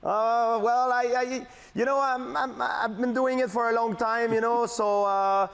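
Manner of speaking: high-pitched, hesitant mock host; Michael Landsberg. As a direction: stumble through the answer in a high imitated voice. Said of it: "you go back to being [name]".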